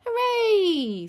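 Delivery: high, loud cheer; drawn-out gleeful hooray; children's hooray. Acted. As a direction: cheer like delighted little children. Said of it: "say Peppa and George".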